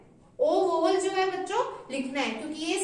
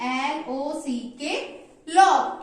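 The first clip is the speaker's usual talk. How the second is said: slow spelling then loud word; 2 semitones lower, 4 dB louder, much slower than usual. Shown as a demonstration. Teacher spelling lock then calling it out. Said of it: "spelling क्या बनी".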